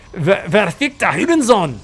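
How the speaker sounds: put-on German curse, last word stressed; mock German swearing. Commentator tries a swear word chat taught him, mock-angry German. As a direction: spit out a German insult in imitation. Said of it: "teach me some more German swear words".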